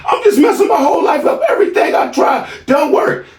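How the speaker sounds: high shouted mimicry with a laugh-cry; son near tears. Son about to cry in front of his father, high shouted wail. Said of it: "he's about to cry".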